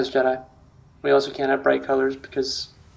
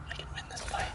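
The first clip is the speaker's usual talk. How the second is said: breathy whisper; 20 dB quieter, faster than usual. Pretend. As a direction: whisper it right into the mic. Whispered line into mic.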